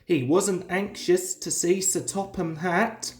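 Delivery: usual speaking voice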